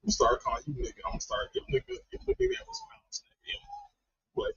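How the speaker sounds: usual speaking voice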